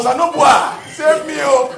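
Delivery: loud, high Edo plea; Edo cry for help. Pretend. Someone whose hand is caught, calling out in Edo. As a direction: cry out to God in your real Edo voice. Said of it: "your real accent".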